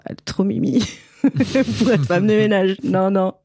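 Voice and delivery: real laugh breaking into the words. Real, not acted. Commenting on the too-cute actress cast as a cleaner, laughing. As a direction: say it through laughter.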